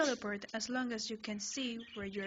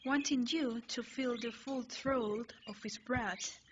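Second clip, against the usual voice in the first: plain reading voice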